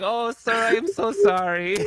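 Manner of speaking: high, slow fake apology of a hotel clerk; disguised caller voice. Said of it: "such a good job disguising it".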